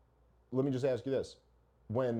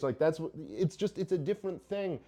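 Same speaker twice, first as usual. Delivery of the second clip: ordinary talk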